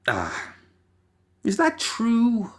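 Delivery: ordinary delivery, nothing marked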